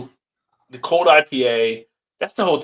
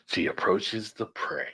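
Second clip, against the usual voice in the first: nature documentary narrator. Pretend. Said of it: "old Animal Kingdom".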